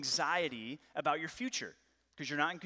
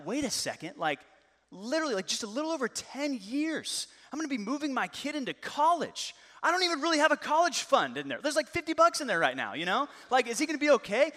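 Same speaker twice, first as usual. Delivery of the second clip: higher, anxious worried dad; rising panic; 8 semitones higher, 5 dB louder than usual. Retold or imitated. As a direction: spiral into worry about the future. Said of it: "I start just losing it".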